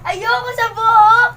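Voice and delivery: loud, whining cry of refusal; whiny shout. Real, not acted. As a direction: wail the refusal, slow and loud. Refusing gross food flavor.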